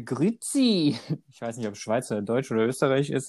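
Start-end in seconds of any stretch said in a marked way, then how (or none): 0.0-1.0 slow, drawn-out Swiss "grüezi"; caricatured Swiss German hello; mock Swiss German accent. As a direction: put on a playful Swiss German greeting. Imitating Swiss greeting.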